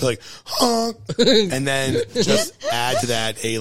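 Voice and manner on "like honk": high honking goose noise. Shown as a demonstration. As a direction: honk like a goose. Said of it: "tutorial on TikTok of how to do a Bill Murray impression".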